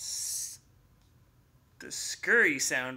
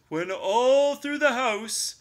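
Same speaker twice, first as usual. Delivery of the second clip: louder, higher recital, slowing at the end; poem recital; 5 semitones higher, 6 dB louder than usual. Acted. Bear reciting the poem at Mouse, pointed. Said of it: "Bear pronounced".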